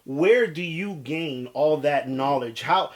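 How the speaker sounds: ordinary talk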